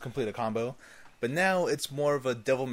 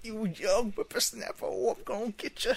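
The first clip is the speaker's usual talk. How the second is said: put-on high crotchety old-man voice; old prospector; 8 semitones higher than usual. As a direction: scold the whippersnapper like a cranky geezer.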